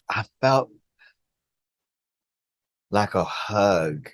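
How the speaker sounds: usual speaking voice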